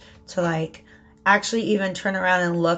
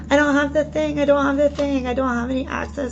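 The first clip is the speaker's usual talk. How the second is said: higher, put-on sulky complaint; mock pouting; 5 semitones higher than usual. Card reader voicing the figure staring at spilt cups, pouting. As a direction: play a pouter moaning in a raised voice. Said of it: "he's turned his eyes and he's pouting".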